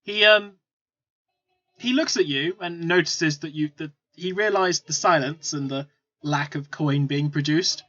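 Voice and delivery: ordinary talk, nothing marked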